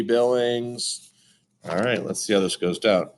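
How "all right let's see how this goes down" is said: ordinary delivery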